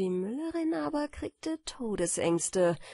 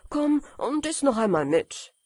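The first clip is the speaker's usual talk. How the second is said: raised, higher inviting call; 6 semitones higher, 6 dB louder than usual. A narrator's reading. Miller spots Böhle and invites him to eat, calling out. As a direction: call it over louder and higher.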